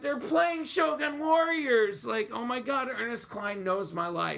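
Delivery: high-pitched gush, retelling a moment of delight; thrilled outburst. Retold or imitated. Fan retelling the moment a favourite toy turned up in the book, thrilled. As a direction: relive the thrill in a high, excited voice.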